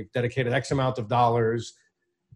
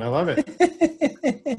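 said through high laughter; laughing; 12 semitones higher, much slower than usual. Real, not acted. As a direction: laugh while saying it. Host jokes about Kevin's employees.

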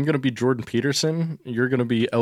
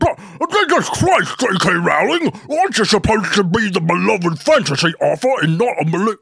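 loud, high, mouthy British; exasperated British shouting, pitched way up; British accent; 7 semitones higher, 8 dB louder than usual. Acted. Agent berates J K Rowling, over-loud British accent. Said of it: "when I do a British accent".

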